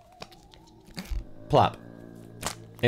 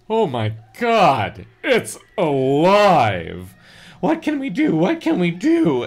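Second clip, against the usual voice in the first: slow, loud, then higher panic; terrified girlish voice; 6 semitones higher, 7 dB louder, slower than usual. Acted. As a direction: squeal in terror. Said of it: "his own terrified girlish voice".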